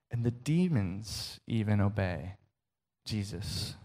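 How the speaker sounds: whisper-soft punchline; quiet awestruck landing; hushed emphasis. Real, not acted. Dramatic restatement after pause.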